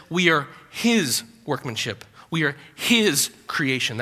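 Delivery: "his" set apart by pauses; measured repeat, landing on "his"; stress on his. Shown as a demonstration. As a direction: isolate and stretch "his" each time. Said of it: "he puts emphasis on the word his".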